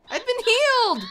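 high, loud cry of delight; elated shout. Pretend. Player finding the character healed, elated high shout. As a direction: shout it like a miracle cure. Sudden relief in the game.

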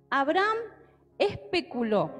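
ordinary delivery

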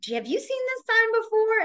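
teacher asking about a new sign; lightly voiced veteran teacher. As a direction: ask a coworker brightly. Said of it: "She came in to ask my coworker".

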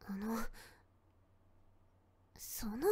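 whispered, hesitant and shy; embarrassed whisper. Acted. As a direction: barely breathe the words out. Embarrassed girl can't say it.

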